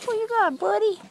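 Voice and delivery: high, loud dog-talk voice; excited pet talk. Real, not acted. Owner asking buddy what he got, excited. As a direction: greet the dog's find, high and loud.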